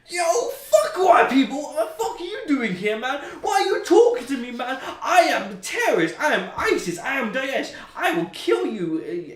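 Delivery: high, loud mock-deranged shouting; imitated furious rant. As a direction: yell it like a raving, threatening prisoner. Acts out the ranting prisoner.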